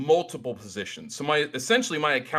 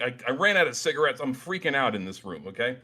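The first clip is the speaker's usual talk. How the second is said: ordinary talk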